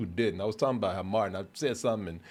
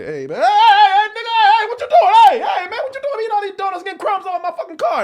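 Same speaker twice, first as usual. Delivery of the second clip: brother's voice; 13 dB louder than usual. Retold or imitated. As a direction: bellow at him like the angry older brother. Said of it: "quote my brother... this is how he just speaks".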